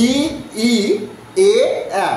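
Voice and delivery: "ই, ই, এ, আ" as isolated pure vowels; measured chant of "ই, ই, এ, আ", gaps between; front vowel drill. Shown as a demonstration. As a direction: sound out each of "ই, ই, এ, আ" separately. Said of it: "তোমাদের দিকে তাকিয়ে বলছি".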